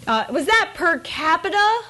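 ordinary talk

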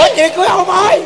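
high, loud shouted objection; outraged protest. Acted. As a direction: shout it in comic disbelief. Grandson protests at bride offered.

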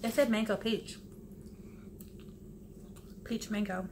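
food in mouth; muffled chewing speech; talking while chewing. Real, not acted. Seen 1: Bites food before; cheeks bulge, jaw works, fingers at lips while speaking.

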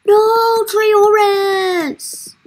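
loud, high cry of mock despair; mock anguished cry. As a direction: cry out high, playing up the loss. Cries out for lost villager.

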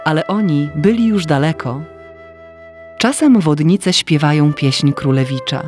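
ordinary delivery

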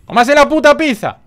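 high, loud bossy yell; mock furious shout. Delivery driver to customer about the pizza, mock furious shout. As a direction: yell the order in mock outrage. Orders the game customer to eat.

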